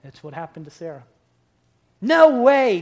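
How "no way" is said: loud, high incredulous cry; Sarah's scoffing no way. Retold or imitated. Pastor voicing Sarah scoffing at God, loud disbelief.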